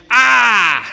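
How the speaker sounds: loud, high cry of pain; loud scream. Acted. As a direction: scream out as if hurt from a fall. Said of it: "and screams".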